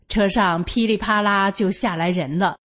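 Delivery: ordinary delivery, nothing marked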